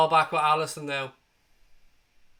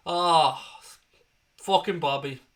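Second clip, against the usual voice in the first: ordinary talk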